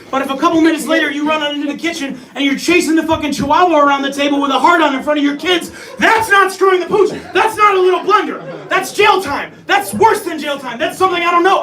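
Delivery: loud, high, escalating tirade; hammed-up moral outrage at full volume; outraged rant. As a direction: build to indignant shouting. Outraged rant about the offense.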